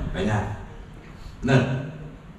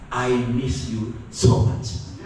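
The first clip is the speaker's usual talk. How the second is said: tender longing; 4 semitones lower, much slower than usual. Retold or imitated. Longing spouse's words.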